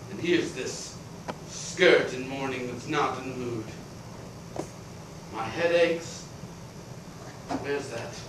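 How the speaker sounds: soft, low, spent grumble; weary muttering. Acted. Exhausted creditor grumbling to himself, quiet and worn. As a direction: mutter to yourself, drained.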